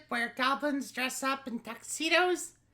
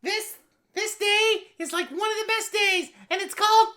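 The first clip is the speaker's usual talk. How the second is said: higher, much louder, thrilled; excited gushing; 5 semitones higher, 7 dB louder, faster than usual. Acted. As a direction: rave about it with joy. Co-host raving about holiday.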